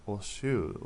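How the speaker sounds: normal talking voice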